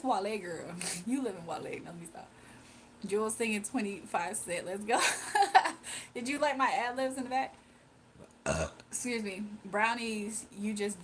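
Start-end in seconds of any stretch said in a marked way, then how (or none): 5.1-6.1 breathy high laugh. Real, not acted. Laughing about ad-libs.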